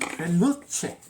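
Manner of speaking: "Translucent" with rolled Italian r; Italian accent. Pretend. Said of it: "No, it is not".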